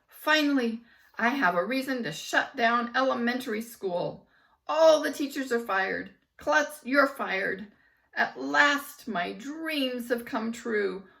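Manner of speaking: loud, high villain gloat. A narrator's reading. Principal gloating as he fires the teachers. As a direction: crow over the fired teachers.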